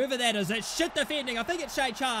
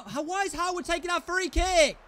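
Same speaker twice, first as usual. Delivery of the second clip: ordinary talk, nothing marked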